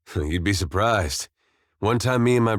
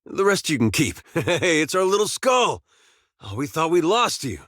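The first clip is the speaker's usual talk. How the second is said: higher-pitched doting voice; fond cooing; 6 semitones higher than usual. Acted. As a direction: coo over a long-lost little friend. Coaxing and doting on little skull.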